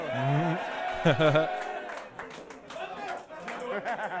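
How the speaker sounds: amused laugh; real laughter. Real, not acted. Reviewer watching a rap battle clip, real amused laughter.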